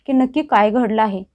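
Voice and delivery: ordinary delivery, nothing marked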